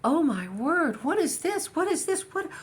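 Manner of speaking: high, loud astonished exclamations; Sammy Hanna amazed. Retold or imitated. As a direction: gasp it out as if you can't believe what you're reading. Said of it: "he went".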